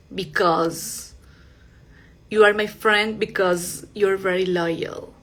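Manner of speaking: because, ending on a buzzing Z; slow, careful because with a Z. Shown as a demonstration. Teacher reading a slow example sentence. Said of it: "ese porque con sonido de z".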